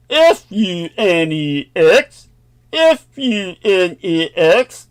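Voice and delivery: put-on slow, weak Swedish accent joke. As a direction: try a Swedish accent and fail. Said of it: "I couldn't do a Swedish accent".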